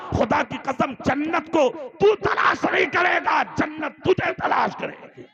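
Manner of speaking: loud, high fiery sermon voice; preaching at full force. Acted. Preacher exhorting the Talakpur crowd, fiery sermon delivery.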